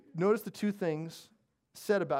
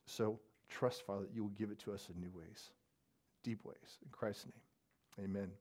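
soft, hushed prayer voice; 3 semitones lower, 5 dB quieter than usual. Acted. Pastor leading the congregation in prayer, hushed and reverent. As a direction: pray it quietly and reverently.

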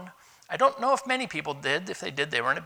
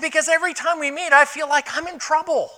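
higher and louder, blurting it out fast; exasperated outburst; 11 semitones higher, 6 dB louder than usual. Retold or imitated. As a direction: let the frustration spill out in a rush. Retold outburst to his boss.

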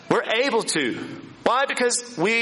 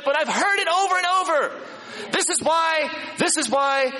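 raised-pitch, insistent complaining; mock exasperation; 6 semitones higher than usual. Preacher acting out people's repeated excuses, exasperated. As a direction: imitate someone fed up and complaining.

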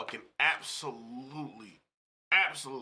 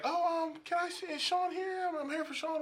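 much higher, hesitant client asking for Sean; high mock client voice. A coworker imitating a confused client, high and hesitant. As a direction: play the unsure client, pitched way up. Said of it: "They'll be like".

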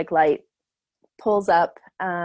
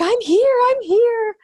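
high, loud breathless announcement; doctor arriving; 7 dB louder, slower than usual. Retold or imitated. Doctor running out to the car announces herself, high excited call. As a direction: burst in calling out, high and loud. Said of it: "she's like".

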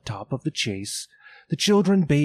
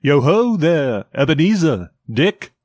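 comfortable, oily, fat-voiced call; big jolly boss hailing his apprentices; rich jovial voice; 3 semitones higher, 5 dB louder than usual. A narrator's reading. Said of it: "called out in a comfortable, oily, rich, fat, jovial voice".

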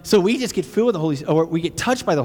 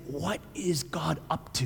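hushed wonder; 9 dB quieter than usual. Retold or imitated. Unbelievers voiced in Acts.

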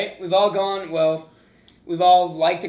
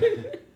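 high laugh. Real, not acted. Laughing at the quote.

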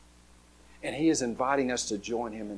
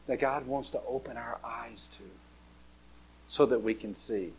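whispered, much softer; 3 semitones lower, 9 dB quieter than usual. Real, not acted. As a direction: lean in and whisper it. Sermon hushed emphasis.

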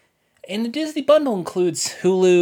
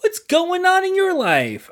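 friendly chat voice; 4 semitones higher, 3 dB louder, slower than usual. Retold or imitated. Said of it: "This is the".

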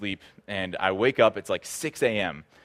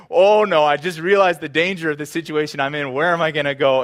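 panic; 6 semitones higher, 8 dB louder than usual. Retold or imitated. Realizing danger, looking for escape.

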